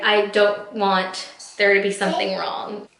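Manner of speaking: normal talking voice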